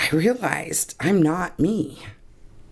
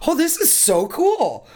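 amazed delight; 14 semitones higher than usual. Retold or imitated. Retelling the moment she snapped back, high amazed delight. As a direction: pitch leaps much higher in giddy wonder. Wonder at becoming conscious.

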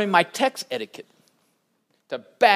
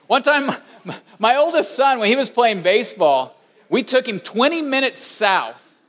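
normal talking voice

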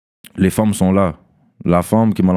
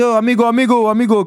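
high, carrying call across the crowd; high-pitched shout of amigo; 13 semitones higher than usual. Retold or imitated. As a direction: hail him from a distance, pitched way up. Calling out to a stranger.

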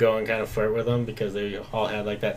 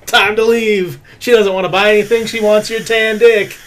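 loud, high-pitched panicked retelling; high, hammed-up alarmed shout; mock alarm; 12 semitones higher, 14 dB louder than usual. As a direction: yell it like a comic warning to get out. Retelling his alarmed warning to flee.

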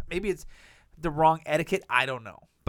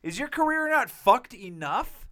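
incredulous rant; 10 semitones higher, 3 dB louder than usual. Real, not acted. Ranting at Woody Allen.

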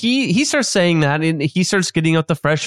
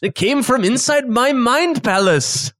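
chef character voice; 6 semitones higher, slower than usual. Acted.

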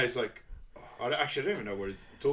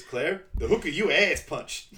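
loud, slow, higher character retort; 5 semitones higher, 7 dB louder than usual. Retold or imitated. Retelling the friend's accusation, loud and drawn out. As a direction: lean slowly into the comic accusation. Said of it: "this is where T J enters".

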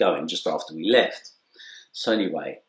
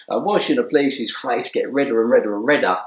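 usual speaking voice

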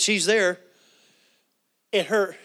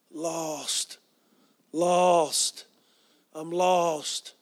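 long drawn-out, moaned words; soul crying out; much slower than usual. Retold or imitated. Curled-up lady in nursing home, crying out lost. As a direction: stretch each "lost" into an imitated mournful cry. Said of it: "Her soul was crying out".

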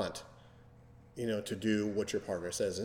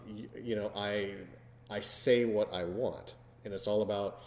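ordinary talk

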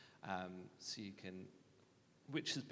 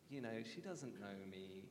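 usual speaking voice

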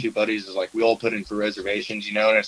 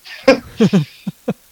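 high laugh; 11 semitones higher, 5 dB louder than usual. Real, not acted. Laughing at own story.

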